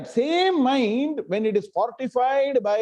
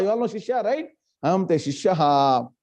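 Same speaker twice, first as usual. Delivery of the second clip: normal talking voice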